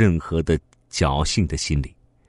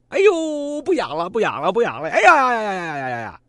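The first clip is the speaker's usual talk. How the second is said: high, fast whoops of relief; relieved joy. Acted. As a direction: rejoice without holding back. Thief relieved and overjoyed. (如释重负，高声欢呼)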